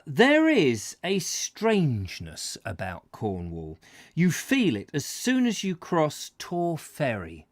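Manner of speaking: measured read-aloud voice; story narration. Acted. Host reading a Cornwall travel book to viewers, narrating.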